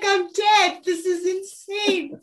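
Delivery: high, loud giddy disbelief; starstruck squeal. Retold or imitated. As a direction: squeal like a dazed fan. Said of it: "You're like".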